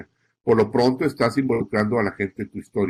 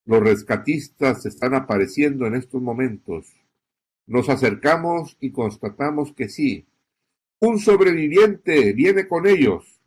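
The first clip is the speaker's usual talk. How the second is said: raised voice on the key line. Shown as a demonstration. Reporter announcing a survivor on live coverage, voice rising. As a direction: lift the voice for the hopeful surprise. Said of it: "Acentúa y eleva la voz".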